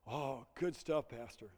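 ordinary talk

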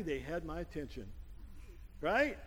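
normal talking voice

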